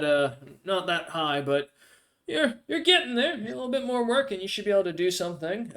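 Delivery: lightly voiced guild official, pitched a little up; Goliath guild official. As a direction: play a hint of a smiling Goliath official.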